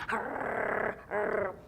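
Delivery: low playful growl imitating a dog. Describing her dog at play, a low imitated growl. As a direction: growl like a playful pit bull. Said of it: "my dog's going".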